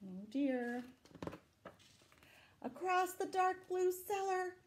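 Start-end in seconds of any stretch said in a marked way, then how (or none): none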